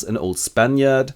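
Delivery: ordinary talk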